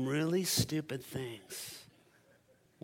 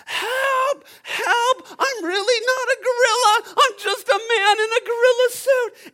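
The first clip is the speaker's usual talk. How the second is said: very high, loud scream for help; panicked screaming; 12 dB louder than usual. Acted. Man in gorilla suit facing a lion, terrified screaming. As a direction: shriek it as if about to be eaten. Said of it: "he starts screaming".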